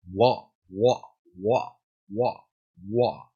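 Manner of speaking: low "wa" syllables, lax lips; "wa" in place of a firm ba; weakened V drill. Shown as a demonstration. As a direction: repeat "wa" softly as separate glides. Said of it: "es un poco menos pronunciada".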